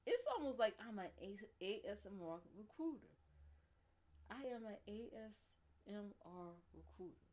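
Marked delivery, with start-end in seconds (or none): 4.3-7.3 playful ASMR announcement. Pretend. Repeats line slowly, as ASMR recruiter.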